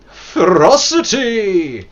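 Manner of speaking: FEROCITY loud, high and drawn out; hammed-up slow cry; relished exclamation. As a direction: roll the word out with gusto. Savouring the word loudly.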